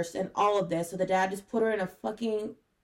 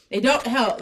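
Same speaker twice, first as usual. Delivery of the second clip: raised, emphatic voice; yelling; 2 semitones higher, 6 dB louder than usual. Real, not acted. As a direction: insist it loudly to the co-host. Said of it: "She's yelling straight into the microphone".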